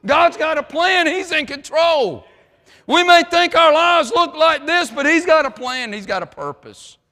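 loud, high, driving sermon voice; full-force preaching. Acted. Preacher rousing the congregation, loud and driving. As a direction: preach it out over the crowd.

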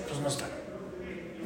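breathy near-silent aside, slow; whispered aside. Real, not acted. Speaker to the mothers, whispering a rebuke. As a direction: lean in and say it under your breath.

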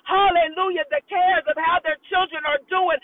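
rising, impassioned preacher's prayer; fervent prayer. Acted. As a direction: pray it out with growing fire. Leads fervent intercessory prayer.

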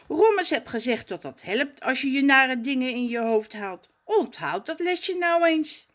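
put-on a little boy coaching himself; young Raf voice.

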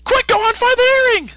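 very high, loud cartoon panic; panicked Goku. Goku urging Gohan to find the earring, high and frantic. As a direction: yelp it out urgently. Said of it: "Goku's like".

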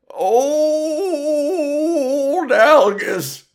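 a creaky future prospector introducing his show; hammed-up old codger; Old Algus. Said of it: "this is Old Algus, podcasting from the future".

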